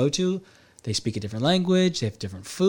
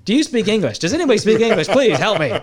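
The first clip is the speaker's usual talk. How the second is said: louder, higher, laughing through a frantic plea; mock panicked plea; 7 semitones higher, 6 dB louder than usual. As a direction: ham up a lost tourist begging for help, half laughing. Panicked tourist begging for help.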